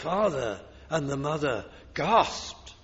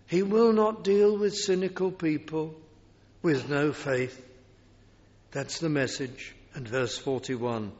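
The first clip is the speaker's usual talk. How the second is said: normal talking voice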